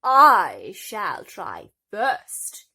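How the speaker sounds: slow, loud haughty boom. Acted. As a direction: boom over the daughters. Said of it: "boomed their mother".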